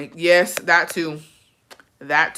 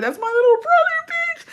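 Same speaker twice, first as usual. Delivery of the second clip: very high, louder squeal of protest; exasperated outburst; 4 dB louder than usual. Retold or imitated. Telling everyone he's her brother, exasperated squeal.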